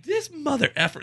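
high-pitched grumbled insult; mock cursing aside. Cursing caller on hold.

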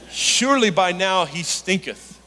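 lightly voiced posh English Jesus from Bible films; hint of a British film Jesus; mock British accent. Shown as a demonstration. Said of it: "you have to say it with a British accent".

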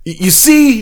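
very high, loud stammer; nervous stammer. Acted. Deer Man stammering to the furries, very high. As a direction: stumble into it.

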